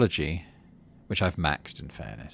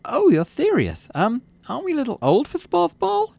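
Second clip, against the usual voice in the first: put-on incredulous girl; 6 dB louder than usual. Girl doubting they still play sports ball, high incredulous voice. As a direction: play her amazed you mean it.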